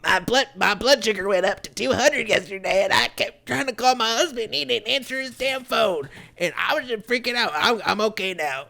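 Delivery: hammed-up retelling of her troubles; mimicking the coworker.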